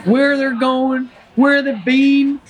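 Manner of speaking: Forrest Gump. Acted. Forrest muses on people's shoes, slow and in character. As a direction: draw the words out in a naive American voice. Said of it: "play the role of Forrest Gump".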